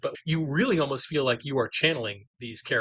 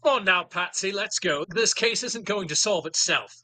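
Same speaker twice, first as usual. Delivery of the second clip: put-on higher-pitched British accent, urging her on; British lawyer accent; 6 semitones higher than usual. British lawyer nagging Patsy to return to the case, British accent. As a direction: play a British lawyer chivvying the writer along. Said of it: "You love accents".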